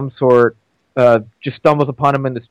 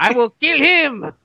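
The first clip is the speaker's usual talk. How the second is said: slow, high henchman threat; Knick-Knack; 7 semitones higher, slower than usual. Acted. Little henchman vowing to kill the spy, slow mock menace. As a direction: draw out a put-on accented threat. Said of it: "as the role of Knick-Knack".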